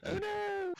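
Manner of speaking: high shocked "oh no"; dismayed cry. Real, not acted. Player shot a human.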